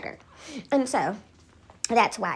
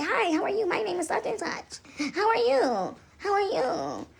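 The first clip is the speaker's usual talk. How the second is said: high, soft put-on hello; mock polite greeting; 6 semitones higher, 5 dB quieter, slower than usual. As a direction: imitate over-sweet strangers introducing themselves. Acting out people introducing themselves.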